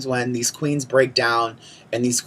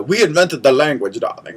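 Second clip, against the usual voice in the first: imitating an odd Puerto Rican accent; mock Puerto Rican accent; weird Puerto Rican accent. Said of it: "some weird Puerto Rican accent".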